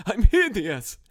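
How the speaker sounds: high, loud hammed-up wail; mock despair. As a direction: melodramatic cry of self-disgust. Mock horror at own reflection.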